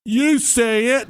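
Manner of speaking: put-on high, slow little-kid you say it; a child pointing the finger; accusing kid. Daughter blaming her dad for swearing, high slow kid voice. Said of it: "She said".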